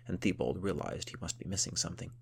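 ordinary delivery

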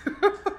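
short high laugh; a quick high laugh; a brief high laugh to ease his embarrassment; light laugh. A narrator's reading. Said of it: "and laughed".

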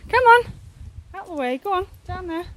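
real high-pitched urging to a chicken; calling to an animal. Real, not acted. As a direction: shoo a hen along in a high voice. Shooing the chicken away.

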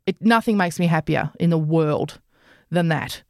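usual speaking voice

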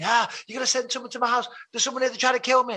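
high, rushed mock scream for help; panicked screaming. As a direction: gabble a frantic plea to the emergency line. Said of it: "and I'm screaming".

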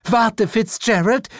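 high, slow call to her husband; high slow wife's voice. A narrator's reading. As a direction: hold him back, uneasy. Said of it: "rief diese".

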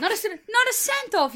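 high-pitched mock outrage; incredulous squeal. Real, not acted. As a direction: squeal it in disbelief. Teasing friend about not paying.

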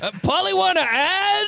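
high silly announcer voice; Mister Ads. Acted. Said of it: "it's me, Mister Ads".

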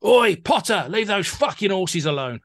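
loud, high heckle from the street; imitated shout. Passer-by heckles a famous actor outside the theatre, imitated shout. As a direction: bellow it like a passer-by calling out. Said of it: "summons up all his strength and he shouts".

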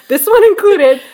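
high, loud burst through a laugh; laughing agreement. Real, not acted. Host joking about own creative projects, laughing.

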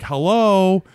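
loud, high call to a machine; calling out hello. Retold or imitated. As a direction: imitate someone trying to wake a computer by voice. Lady calling to sleeping computer.

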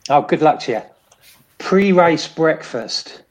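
ordinary talk

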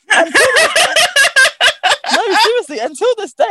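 high-pitched, amused insistence; laughing protest. Real, not acted. Speaker swearing to friend she still doesn't know, laughing. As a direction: insist through laughter, voice shooting up.

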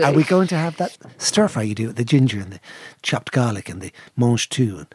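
a lightly put-on, low, sultry English accent; sexy English accent. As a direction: purr it like a seductive English lady, lightly. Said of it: "with a sexy English voice".

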